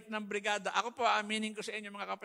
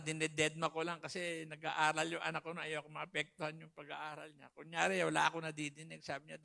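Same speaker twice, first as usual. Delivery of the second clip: ordinary talk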